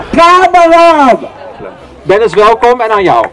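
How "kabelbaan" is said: drawn-out loud call. Real, not acted. Calling out the cable car, drawn out.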